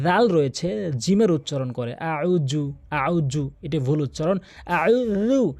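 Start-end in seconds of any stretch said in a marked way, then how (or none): 4.7-5.5 tajweed dhal demo; correct dhal. Shown as a demonstration. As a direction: say আউ জু with dh not j. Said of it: "জিব্বার আগা এবং দাঁতের আগার সঙ্গে লাগাবেন".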